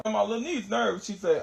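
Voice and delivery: ordinary talk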